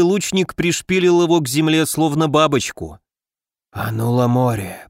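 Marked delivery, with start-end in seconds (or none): 3.7-4.9 low hissed incantation. A narrator's reading. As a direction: hiss the spell through the teeth. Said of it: "прошипел наёмный убийца". (шипящим шёпотом)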